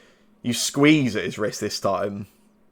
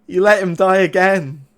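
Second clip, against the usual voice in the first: high, loud sneering villain; mocking guard voice, pitched up; taunting voice; 7 semitones higher, 4 dB louder than usual. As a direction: taunt them with raised, scornful relish. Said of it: "that voice comes through again".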